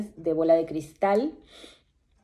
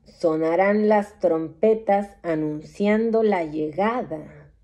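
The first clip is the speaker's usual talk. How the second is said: slow, grave oracle pronouncing the end of days; solemn prophecy read with long pauses; doom-laden prophecy; 3 dB louder than usual. Acted. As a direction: intone each line like a solemn prophet. Reads apocalyptic prophecy aloud.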